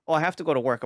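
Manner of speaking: high, casual wife voice, a touch loud; imitating wife. Retelling how his wife shrugged off the alert, high and breezy. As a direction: lightly mimic the unbothered wife. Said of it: "my wife all the while was like".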